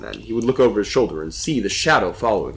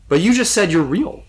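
higher-pitched frustrated objection; growing frustration; 9 semitones higher, 4 dB louder than usual. A narrator's reading. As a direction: object in rising exasperation. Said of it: "growing more and more frustrated".

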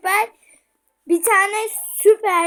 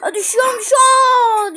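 high frightened yelp, getting louder; screaming while falling; 8 semitones higher, 8 dB louder than usual. Real, not acted. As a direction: yelp as you drop off the edge. Falling in the game. (düşerken bağırarak)

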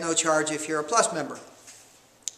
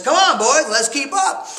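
higher, louder pep shout; rallying call; 6 semitones higher, 5 dB louder than usual. Retold or imitated. Convict rallies fellow prisoners on the road: eager, louder call. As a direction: fire up the crew with a keen, lifted call.

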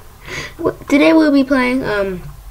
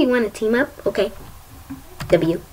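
ordinary delivery

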